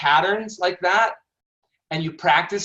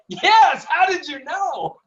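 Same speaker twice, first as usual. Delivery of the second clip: mock surprise; 11 semitones higher, slower than usual. Teasing a date who guessed the restaurant, hammed-up surprise. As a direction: act thrilled that they guessed right.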